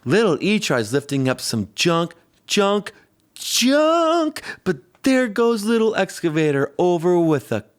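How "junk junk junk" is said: squeaky high strain on junk; effortful high-pitched heave; straining lift. Acted. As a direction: push the word way up as if straining.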